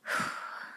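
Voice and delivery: soft, voiceless exhale; deep breath out. Shown as a demonstration. Teacher pausing after the first sentence, soft breath out. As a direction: let out a quiet breath of relief. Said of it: "Now I can take a breath".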